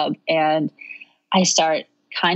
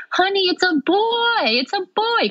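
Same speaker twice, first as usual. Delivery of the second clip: excited announcement. Retold or imitated. New mother telling her stunned husband, excited announcement. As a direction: imitate an elated squeal to a partner.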